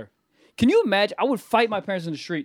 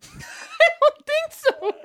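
laughing; much slower than usual. Acted. Laughing mock reply.

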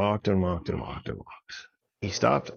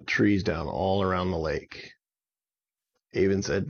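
plain reading voice

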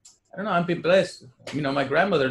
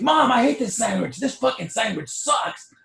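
bratty whiny son; 9 semitones higher, 4 dB louder than usual. Retold or imitated. Friend complaining to mom.

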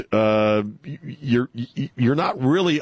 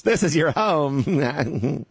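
slow, drawn-out mocking Kamala voice, higher; 5 semitones higher, much slower than usual. Host mocking Kamala, slow, higher put-on voice. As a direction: parody her soothing line to the dreamers. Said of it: "Kamala, what was she saying".

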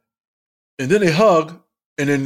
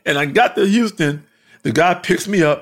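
ordinary talk, nothing marked

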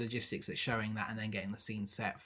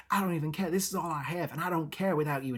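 mild retold outburst, pitched up; losing it; 4 semitones higher than usual. Earl raging at his wife, mildly hammed-up outburst. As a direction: act a man losing it, without shouting. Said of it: "oh, he loses it".

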